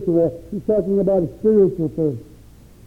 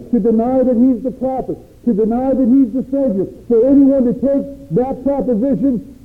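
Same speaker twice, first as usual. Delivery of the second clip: higher, repeated building list; full-force preaching; 4 semitones higher than usual. Acted. Preacher challenging those who deny the Messiah, building. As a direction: stack each "for people who want to deny" higher.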